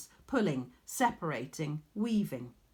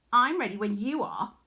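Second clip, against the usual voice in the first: a louder, eager taunt; ready and cocky; confident challenge; 4 semitones higher, 6 dB louder than usual. A narrator's reading. Arachne answering Athene's challenge, confident. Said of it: "Arachne said".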